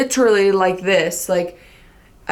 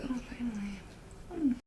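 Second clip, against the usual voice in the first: soft slow flirty aside; 4 semitones higher, 17 dB quieter, slower than usual. Retold or imitated. Retold reply to his message.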